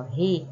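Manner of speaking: Ri with a strong guttural R. Shown as a demonstration. Teacher isolating the strong R sound. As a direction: Ri said with a hard, strong R. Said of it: "O som saiu forte".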